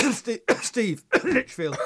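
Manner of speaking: coughing through the name; words broken by coughs; coughing fit. Real, not acted. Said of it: "I've got a bad cough today".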